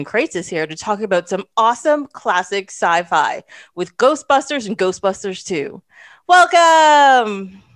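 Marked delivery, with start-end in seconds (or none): 6.3-7.6 big upbeat welcome, higher and louder; loud cheerful welcome; bright welcome. Real, not acted. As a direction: greet the guest with a loud cheer. Host welcoming guest.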